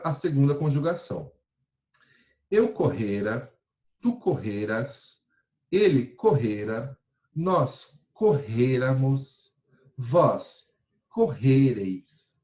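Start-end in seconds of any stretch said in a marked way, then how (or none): 2.5-12.4 slow, deliberate conjugation drill; teacher drilling verb forms; slow recitation. Shown as a demonstration. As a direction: spell out each form slowly for the class. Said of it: "teacher reciting conjugation slowly".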